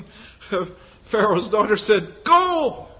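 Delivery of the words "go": sharp command. Acted. Pharaoh's daughter answers Miriam.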